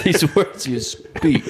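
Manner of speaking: slow, laughing parody; mock-solemn imitation. Friend echoing the improv dad, slow amused mock gravity. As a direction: echo the grave dad through a laugh.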